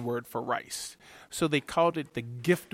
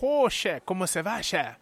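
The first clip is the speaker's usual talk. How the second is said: put-on Cajun accent like Justin Wilson; 3 semitones higher than usual. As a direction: greet the chef in a jolly Cajun accent. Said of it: "He had an accent".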